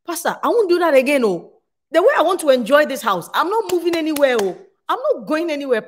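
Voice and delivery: high, loud and quick, gleeful vow to stay; playful exclaim. Retold or imitated. Wife promising pastor she'll stay, loud gleeful vow. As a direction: sell it like a happy, teasing promise.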